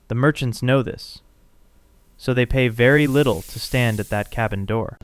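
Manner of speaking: ordinary delivery, nothing marked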